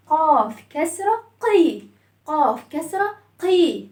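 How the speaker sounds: normal talking voice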